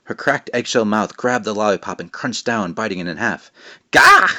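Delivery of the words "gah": high, loud cry of pain; startled shout gah. A narrator's reading. Jack shouting as the witch bites, sharp yelp. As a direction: yelp as the lollipop breaks. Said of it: "Jack shouted".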